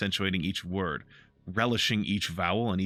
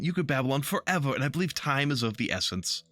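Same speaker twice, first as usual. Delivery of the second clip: higher, teasing Harlequin voice; flamboyant painted elf; 6 semitones higher than usual. Acted. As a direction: play him amused and mocking, stretching the words.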